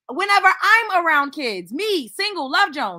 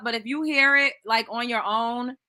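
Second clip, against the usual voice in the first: ordinary talk, nothing marked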